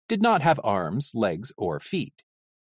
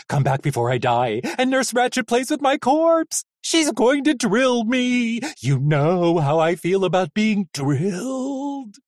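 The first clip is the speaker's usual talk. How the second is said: panicked pleading; 10 semitones higher, 4 dB louder than usual. A narrator's reading. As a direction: beg in terror. Panicking robot begs.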